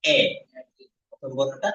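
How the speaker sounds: ordinary talk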